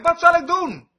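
high, imploring mock outcry; retold cry for help. Moses to God as a small trial comes, imitated high crying out. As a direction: call it out imitating a desperate Moses, pitched well up. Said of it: "Mozes begon te roepen".

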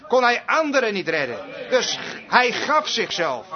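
ordinary talk, nothing marked

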